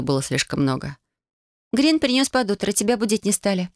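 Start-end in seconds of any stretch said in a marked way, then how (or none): 1.7-3.7 put-on bright girl voice. Ulyana's voice.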